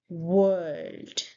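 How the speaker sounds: WORLD drawn out with a soft r; slow, falling WORLD starting on w; soft r demo. Shown as a demonstration. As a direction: model WORLD sound by sound. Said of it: "there is soft R sound".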